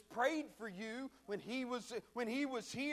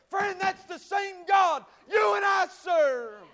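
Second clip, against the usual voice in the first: high, loud, excited sermon delivery; full-force preaching; 4 semitones higher, 7 dB louder than usual. Real, not acted. Preacher telling friends of the glassy water, full-force preaching. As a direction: preach it hard, building to a shout.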